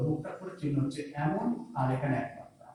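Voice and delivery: ordinary talk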